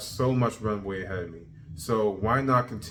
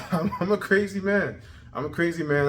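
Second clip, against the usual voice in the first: laughing boast; 8 semitones higher, 4 dB louder than usual. Real, not acted.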